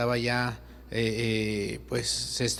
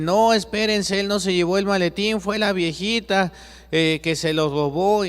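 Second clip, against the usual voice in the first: high, loud, urgent call to stop the woman; shouting to stop her; 7 semitones higher, 4 dB louder than usual. Acted. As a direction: yell after her so she drops the briefcase. Juan wants to shout from cross. (Juan grita desde la cruz a la señora, alto y urgente)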